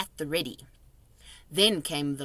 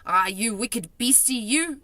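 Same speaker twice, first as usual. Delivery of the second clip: scolding; 4 semitones higher, 4 dB louder than usual. A narrator's reading. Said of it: "he cried".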